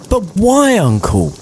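high pleading nephew; nephew crying out. Acted. Fred crying out to his uncle, pitched high. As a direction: whine it up high. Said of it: "cried Scrooge's nephew".

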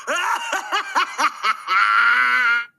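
big menacing evil laugh; villain laugh. Acted. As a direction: cackle like a gloating villain. Villain laughing after threat.

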